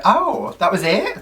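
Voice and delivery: mock-surprised, high-pitched "oh"; retold surprise. Vlogger retelling how quick it was, surprised. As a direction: re-enact mild shock, starting high. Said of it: "I was so shocked".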